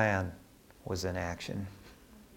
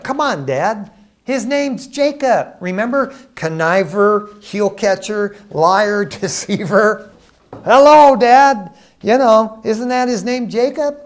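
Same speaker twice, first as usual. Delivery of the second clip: high, loud, hammed-up indignation; exasperated protest; 8 semitones higher, 8 dB louder than usual. Esau reminds Dad of his brother's name, acted exasperation. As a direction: protest to Dad, leaning on each accusation.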